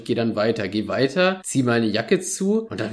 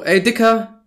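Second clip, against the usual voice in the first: loud calls, pitch thrown high; yelling hallo at a man passed out on the street; shouting to wake him; 9 semitones higher, 9 dB louder, much slower than usual. Retold or imitated. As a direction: bellow at a sleeping stranger. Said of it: "und ruf so".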